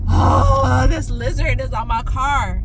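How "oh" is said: loud, high gasped "oh"; startled cry. Real, not acted. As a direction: burst of fright. Startled by the lizard again.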